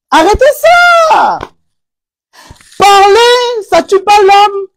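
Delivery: high, loud exasperated outburst; shouting down the viewers; scolding shout. Real, not acted. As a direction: yell it at them to stop. Scolding her audience.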